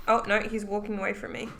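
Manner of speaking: ordinary delivery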